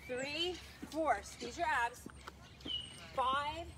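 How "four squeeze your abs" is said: usual speaking voice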